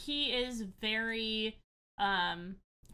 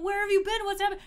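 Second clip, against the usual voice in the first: high, louder eager questions; excited reunion; 10 semitones higher, 6 dB louder, much faster than usual. Acted. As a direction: pour them out, overjoyed to see them. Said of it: "and he's like".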